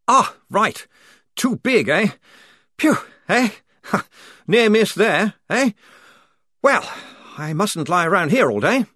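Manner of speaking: high, loud flustered relief; laughing off a near miss; relieved lieutenant. Acted. Lieutenant babbles relief to Perks, high and loud.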